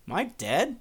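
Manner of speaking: high, rising disbelief; stunned question. Acted. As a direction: ask it as a dawning, incredulous realisation. Hero realises he died.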